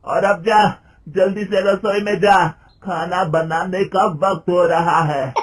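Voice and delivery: loud, high, tearful scolding; a furious mother-in-law berating her daughter-in-law for wanting a job; angry mother-in-law scolding. A narrator's reading. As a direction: scold the daughter-in-law hotly, near tears. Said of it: "गुस्से में कहती है".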